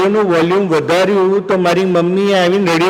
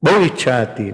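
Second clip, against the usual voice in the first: ordinary delivery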